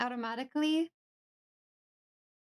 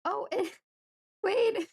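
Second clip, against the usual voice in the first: laughing; 10 semitones higher, 4 dB louder, slower than usual. Real, not acted. TTS unexpectedly works, laughing.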